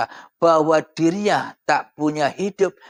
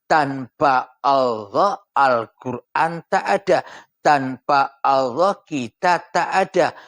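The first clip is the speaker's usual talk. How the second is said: preaching; 3 semitones higher, 3 dB louder than usual. Acted. Opening sermon passage, slow emphatic.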